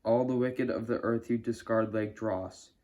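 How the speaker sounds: ordinary delivery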